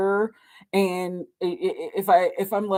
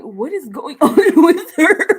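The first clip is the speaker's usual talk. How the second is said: louder, slower, broken by a laugh; puzzled question while cracking up; laughing bewildered quote; 7 semitones higher, 11 dB louder than usual. Retold or imitated. Client wondering about her silent friend, laughing. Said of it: "I'm like".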